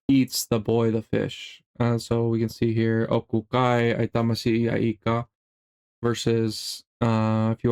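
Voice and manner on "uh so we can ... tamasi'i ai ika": normal talking voice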